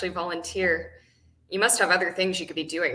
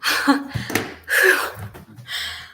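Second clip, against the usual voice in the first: breathy burst of laughter; amused laugh; 5 semitones higher, 4 dB louder than usual. Acted. Caller hears the volunteer change her answer, amused laugh.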